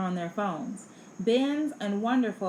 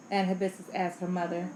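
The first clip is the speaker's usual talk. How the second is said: plain reading voice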